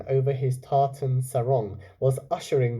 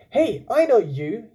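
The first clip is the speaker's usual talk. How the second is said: lightly voiced eager young man, a bit louder; young man's voice; 9 semitones higher, 6 dB louder than usual. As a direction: call out, surprised to recognise him. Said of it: "he said".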